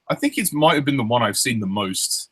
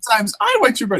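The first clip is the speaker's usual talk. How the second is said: much higher squeaky confession; cartoon voice; 12 semitones higher, faster than usual. Correcting friend's quote.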